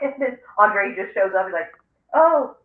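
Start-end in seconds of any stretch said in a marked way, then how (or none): 2.1-2.6 louder, high-pitched retold surprise; mock surprised Oh. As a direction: act out a sudden discovery. Acting out Andre showing up.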